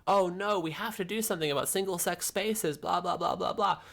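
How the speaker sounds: sing-song parody of a reasonable convert trailing into blah blah; mock inner monologue. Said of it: "It's a performance".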